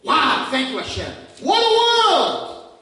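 high, loud, bubbling with delight; joyful prayer. Pretend. Worshipper thanking God at dawn, overjoyed and loud. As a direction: gush about all the good you can do today.

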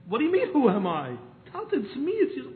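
distressed plea. Retold or imitated. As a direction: cry out in disbelief. Son unrecognized by his father.

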